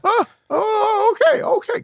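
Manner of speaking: very high, squeaky sheepish okays; voice all high. Retold or imitated. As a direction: go way up high like you know you messed up. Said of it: "when your voice gets all high like that".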